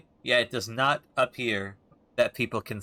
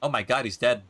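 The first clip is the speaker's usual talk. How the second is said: ordinary talk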